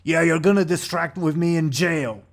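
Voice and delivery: irate tough promising jail; angry bruiser. Acted. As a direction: throw his word back as a threat. Angry bruiser retorts to the ogre.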